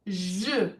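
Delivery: a buzzed "zh", as in "measure"; French-like zh sound. Shown as a demonstration. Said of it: "almost like a French sound".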